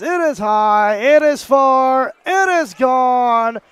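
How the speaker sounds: high, slow, booming radio home run call; John Sterling home run call. Retold or imitated. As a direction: stretch it out like a legendary radio announcer. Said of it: "back then, you know, it was".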